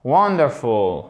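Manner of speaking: Wonderful stressed on WON, drawn out; stress on first syllable. Shown as a demonstration. Teacher models correct stress, WON-derful. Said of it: "l'accent tonique au bon endroit".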